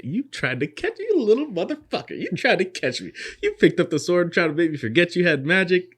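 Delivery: high, loud taunting sneer at a sneaky enemy; mocking taunt. Old mage jeering at the assassin, loud and high.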